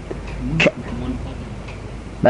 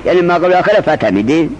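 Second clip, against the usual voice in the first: ordinary talk, nothing marked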